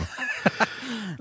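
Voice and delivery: bursting into a high laugh. Real, not acted. Cohost cracking up at the listener's ranking, high laugh. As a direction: cracks up at the list.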